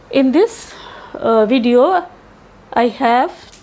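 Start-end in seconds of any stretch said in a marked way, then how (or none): none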